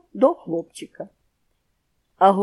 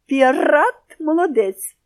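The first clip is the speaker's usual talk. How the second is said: slow, high, loud squawky parrot repeat; agree, parroting it back; 6 semitones higher, 6 dB louder, slower than usual. A narrator's reading. Parrot agrees, parroting the praise. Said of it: "погодився папуга".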